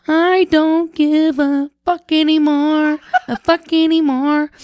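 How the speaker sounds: put-on high, slow little old lady voice; tiny old lady voice. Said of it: "this teeny tiny little old lady voice".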